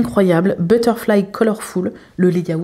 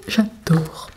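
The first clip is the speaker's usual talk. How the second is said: whispered; 4 dB quieter than usual. Real, not acted. Soft aside of delight.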